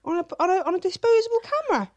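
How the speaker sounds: high, loud disbelief; incredulous. Real, not acted. Disbelief at disposable camera nudes.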